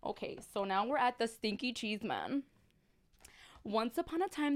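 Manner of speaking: ordinary delivery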